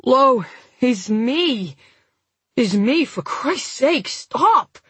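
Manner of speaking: slow slurred plea from an injured man; slurred and broken. A narrator's reading. Injured man begging his panicked attacker, slurred and broken. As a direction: slur it, breaking into a pleading stop. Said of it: "the words slurred and broken".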